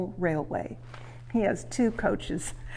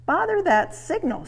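much higher, mildly vexed exclamation; annoyed; 9 semitones higher, 4 dB louder than usual. A narrator's reading. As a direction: grumble at the signal. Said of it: "said Thomas".

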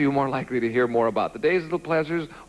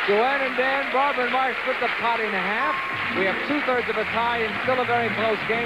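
upbeat announcer voice, higher and bright; game show host; 6 semitones higher than usual. Acted. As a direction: sell the break like a TV host. Host signs off to break.

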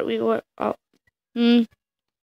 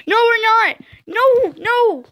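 higher and louder denial; urgent protest; 9 semitones higher, 3 dB louder, slower than usual. Real, not acted. Player denying to the character, high urgent protest. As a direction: cut in fast to deny it.

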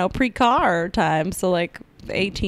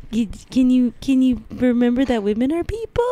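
Eleanor Roosevelt impression; 5 semitones higher than usual. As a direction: stretch out Franklin like a gentle plea. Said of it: "That's her".

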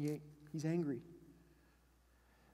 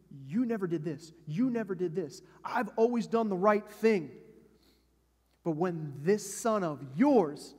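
louder, building grievance, slowing on the last line; the hurt son listing what he never got; mock bitter protest to his father; resentful complaint; 8 dB louder than usual. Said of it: "But he's like".

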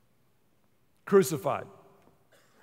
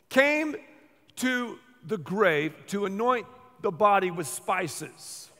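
ordinary talk, nothing marked

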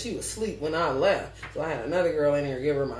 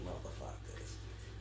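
breathy, slow, hushed curse; whispered aside; 13 semitones lower, 17 dB quieter, slower than usual. Real, not acted. Talking about manipulators, a hushed whispered curse. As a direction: breathe it out under your breath. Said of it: "trailing aside".

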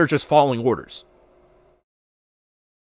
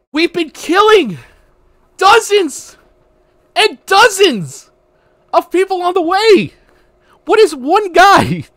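high, loud, slow incredulous rant; ranting at the screen in disbelief; outraged rant; 7 dB louder, slower than usual. Retold or imitated. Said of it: "same exact rant".

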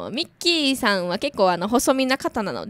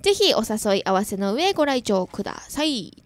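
ordinary talk

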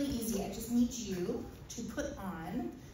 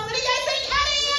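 much higher and louder crowd pitch; excited host; 7 dB louder, faster than usual. Acted. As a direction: whip up the audience. Presenting to the audience.

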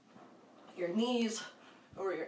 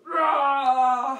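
loud higher roaring shout; giant lion roar; 4 semitones higher, 10 dB louder than usual. Shown as a demonstration. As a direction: roar big for the kids. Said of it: "Five big giant lion roars".

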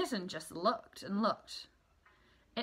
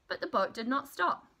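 ordinary delivery, nothing marked

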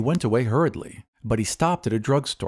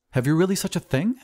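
high, amazed question; incredulous and eager; astonished; 8 semitones higher, faster than usual. Acted. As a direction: ask it amazed, pitch up. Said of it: "cried the glassblower".

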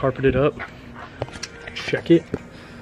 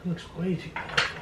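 nervous whisper; 5 semitones higher, 10 dB quieter than usual. Real, not acted. Nervous first time with saw.

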